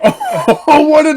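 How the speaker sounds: loud, high, through laughter; laughing outrage. Retold or imitated. Friend seeing the driver's screenshot, laughing outrage.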